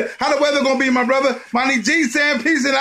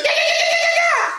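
very high squealing yeahs; high excited yeahs. Real, not acted. Fan squealing over beating the Saints. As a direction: shriek them with joy. Celebrating win.

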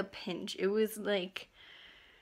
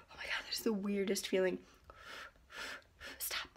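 whisper; 6 dB quieter than usual. Real, not acted. Whispering about sore nose.

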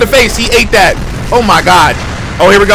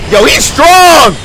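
excited yelling; 6 semitones higher than usual. Real, not acted. Streamer amazed at a strong fighter, high excited yell.

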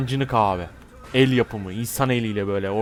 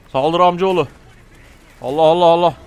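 higher, raised charging chant; battle cry; 4 semitones higher, 5 dB louder than usual. Pretend. Player urging his cousin to attack, raised battle cry. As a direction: cheer your cousin into the charge.

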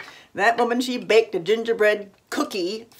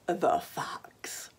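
ominous whisper; 10 dB quieter than usual. A narrator's reading. Fox's ominous arrival.

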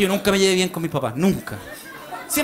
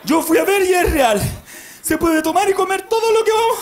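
father shouting to family; 9 semitones higher than usual. Retold or imitated. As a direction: whip the family up like a crazed dad. Father rallying family at hotel.

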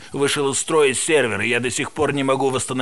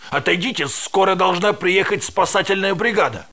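higher urgent warning; urgent order; 7 semitones higher than usual. Acted. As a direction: shout them back, urgent. Ordering people back.

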